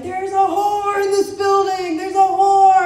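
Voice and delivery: high, loud furious movie scream; screaming wife. Retold or imitated. Comic quoting a Goodfellas wife confronting the mistress, shrieking. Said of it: "she's like".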